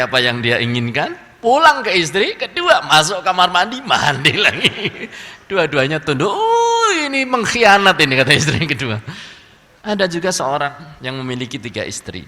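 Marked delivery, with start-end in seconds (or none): 6.3-8.1 a high, loud wailing "Oh" from the jealous first wife; betrayed wife. Acted. First wife thinks the second wife betrayed her, much higher and louder. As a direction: cry out shrill and high as a wife who feels betrayed.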